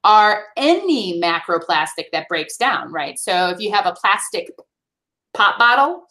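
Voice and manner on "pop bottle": Midwestern accent. Real, not acted. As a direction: pop bottle in a Midwestern accent, pronounced /pʰɑtb ɑtəl/ with an open a. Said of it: "sorry for my Midwestern accent".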